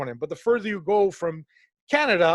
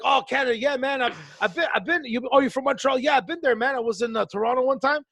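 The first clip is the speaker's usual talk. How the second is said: higher, eager tourist voice; higher, eager clueless American; chatty clueless American; 6 semitones higher than usual. Retold or imitated. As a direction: gush about a trip like a clueless tourist. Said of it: "They're like".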